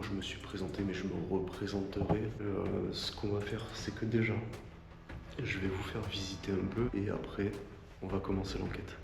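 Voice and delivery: usual speaking voice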